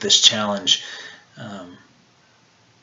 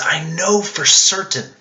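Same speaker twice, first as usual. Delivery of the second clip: higher, emphatic cry of certainty; confident cry; 5 semitones higher, 3 dB louder than usual. Acted. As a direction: declare it with conviction, lifted and deliberate. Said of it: "not a timid way of speaking ... It's crying out like this".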